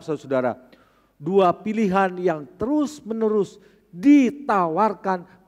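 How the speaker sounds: loud, high preaching with slowed emphasis; fiery preaching. Acted. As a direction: preach it hard to the congregation. (berkhotbah dengan berapi-api, keras dan tinggi)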